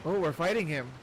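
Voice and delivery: normal talking voice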